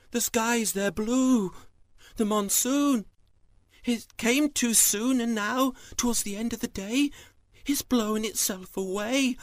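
high-pitched amazed exclamations; astonished farmer. Acted. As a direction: marvel loudly at what you see. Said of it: "gasped with surprise".

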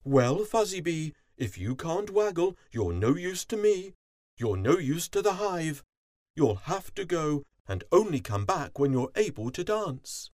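high, forceful royal scolding; stern queen bee. A narrator's reading. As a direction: banish a subject with authority.

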